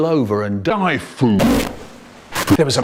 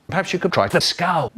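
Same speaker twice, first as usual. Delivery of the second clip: usual speaking voice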